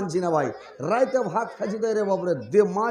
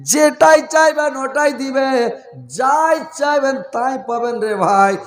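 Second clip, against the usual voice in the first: impassioned preaching; 3 semitones higher, 6 dB louder than usual. Real, not acted. Preacher urging the congregation on night prayer, impassioned preaching.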